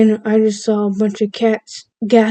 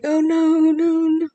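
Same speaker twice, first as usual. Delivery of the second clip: mom comforting; 8 semitones higher, 4 dB quieter, slower than usual. Retold or imitated. Mom comforting crying child.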